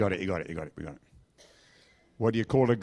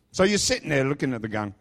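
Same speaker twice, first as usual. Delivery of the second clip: usual speaking voice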